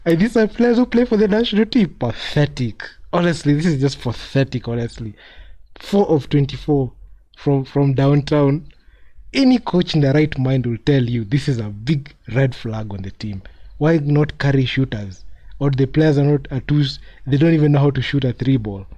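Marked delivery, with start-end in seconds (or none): none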